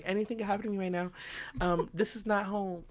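fast, soft mock-panicked rush; mock frightened. Retelling her fear in the parking lot, mock panicked. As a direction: gabble it like a helpless damsel. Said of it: "petite and small in my head".

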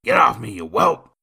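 loud, high angry bark; drunkard's angry shout; barked order. A narrator's reading. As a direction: bark it to shove someone off. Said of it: "Nathan barked".

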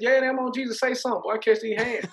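high, loud, cocky challenge; militant retort. Retold or imitated. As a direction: dare them to say something. Retold militant reply to mockers.

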